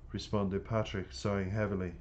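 ordinary delivery, nothing marked

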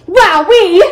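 mildly screamed wow of excitement; excited scream. A narrator's reading. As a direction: burst out with delight. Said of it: "I screamed excitedly".

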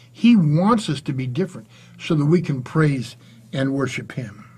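normal talking voice

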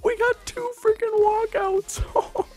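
very high, drawn-out squeal; overjoyed, stretched-out high cry; ecstatic celebration. Real, not acted. Celebrating two walkouts, house asleep.

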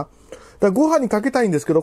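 ordinary talk

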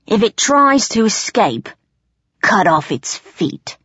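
rough goblin chief's command; ordering. A narrator's reading. Goblin chief sheathing sword to guard, ordering. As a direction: order it without mercy, slowing at the end. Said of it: "the chief ordered".

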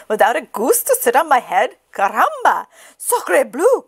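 a hint of chicken excitement; lightly voiced excited chicken; excited hen. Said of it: "cried the chicken".

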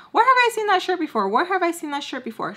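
high, quick mimicked question; casual mimicry. Bubbles asks about the shirt, casual high mimicry. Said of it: "She just says that casually".

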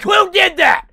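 high, loud angry shout. Retold or imitated. Hit kid yelling at the crowd, angry shout. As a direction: yell it at the whole crowd, furious.

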